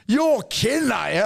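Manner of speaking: high, loud incredulous yelp; amazed disbelief. Retold or imitated. Mate told about his seat, squealing in high, loud disbelief. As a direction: squeal it like you can't believe your luck. Told he'd fly business class.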